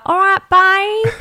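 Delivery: Cockney impression. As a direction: do Rita's Cockney greeting. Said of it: "she was like".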